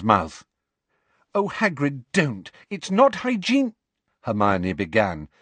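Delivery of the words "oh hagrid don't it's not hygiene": high, urgent scolding; dismayed protest. A narrator's reading. Hermione stopping Hagrid reusing the steak, dismayed. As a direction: squeal an objection.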